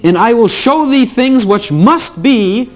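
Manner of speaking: high, slow heavenly proclamation; lightly voiced heavenly herald; trumpet voice. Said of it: "a trumpet kind of voice said to me".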